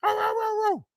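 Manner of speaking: high squeaky arr barking; mocking little yap. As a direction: yap like a tiny dog.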